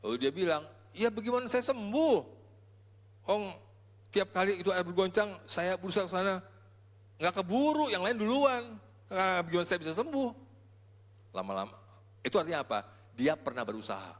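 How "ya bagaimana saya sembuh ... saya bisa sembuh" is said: lightly voiced helpless lame man, higher; a hint of the lame man pleading his case. Preacher voicing the lame man asking how he can heal.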